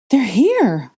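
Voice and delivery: high, loud alarm; alarmed cry. A narrator's reading. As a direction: scream the warning. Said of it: "Hermione cried".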